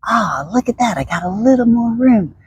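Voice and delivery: showing how enthusiasm sounds; enthusiastic. Shown as a demonstration. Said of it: "if you're enthusiastic in your body and you're like".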